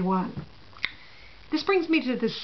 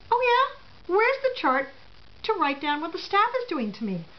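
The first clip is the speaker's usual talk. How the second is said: angry retort; 7 semitones higher than usual. Retold or imitated. Furious retort to the nurse.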